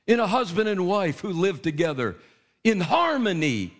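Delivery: full-force revival preaching. Acted. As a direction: preach it out, building with fervor. Preaching to the men.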